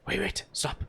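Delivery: hushed, urgent warning; urgent whisper. Acted. Guide whispers a warning to the party, urgent hush. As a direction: whisper a quick warning to the group.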